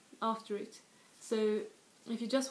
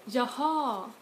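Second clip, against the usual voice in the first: "Jaha" with the second syllable stressed, louder, pitch arching up and falling; last-syllable stress; 3 semitones higher, 6 dB louder than usual. Shown as a demonstration. Listener confirming new information, "Jaha" stressed on the end. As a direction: swell onto the end of "Jaha". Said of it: "stress the last syllable instead".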